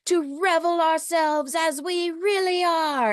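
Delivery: high, slow, theatrical warlock oratory; sustained, hammed-up speech with long drawn-out words; Calabar. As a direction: proclaim it to the masses like a grand villain. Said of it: "keep doing that voice".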